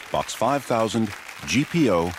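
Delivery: usual speaking voice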